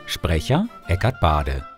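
ordinary delivery, nothing marked

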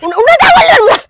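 angry threat. Acted. Wife furious at husband.

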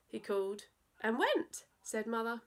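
put-on lively rhyming read-aloud for children; playful children's story narration; storytelling voice. A narrator's reading. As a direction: bounce through the verse like a bedtime poem. Reads a silly poem to children.

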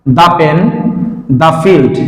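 "দা pen" and "দা field" with duh before each consonant. Shown as a demonstration. Teacher listing examples of the duh form. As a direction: say "দা" as duh before pen and field. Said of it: "দা এর পরে কি আছে".

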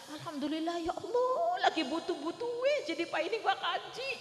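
high-pitched tearful joy, voice cracking with thanks; happy crying. Retold or imitated. Preacher acting a pilgrim who weeps with joy, high tearful. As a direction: wail out thanks through happy tears. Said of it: "nangis seneng".

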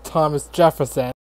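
normal talking voice

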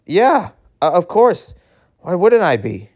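high-pitched, too-quick reassurance; flustered denial. Acted. Boy assuring his visitor he is okay, high and flustered.

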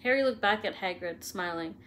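ordinary delivery, nothing marked

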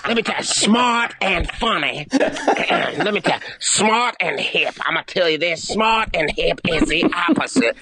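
mock Chris Rock voice that says everything three times; Chris Rock impression. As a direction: hammer every line like a stand-up sermon, with a laugh. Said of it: "That's how he would say it".

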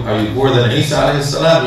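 usual speaking voice

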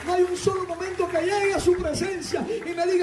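high impassioned preaching rising to a shout; fervent sermon climax, pitched high; full-force preaching. Real, not acted. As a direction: build to a triumphant, high-pitched cry.

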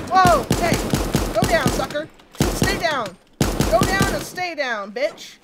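loud battle shouting at the foe; fight yelling. Real, not acted. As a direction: yell him down hard.

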